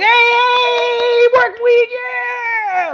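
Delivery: high, loud hyped-up cheer; mock flipping out. Mocking a partying coworker at work, high loud cheer. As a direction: yell it like a partier on Monday. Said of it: "like, flipping out".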